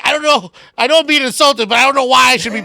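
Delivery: playing the friend's angry confusion; imitating Rudy. Imitating Rudy's confused anger.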